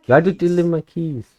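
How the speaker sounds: pitched high and loud, falling at end. Shown as a demonstration.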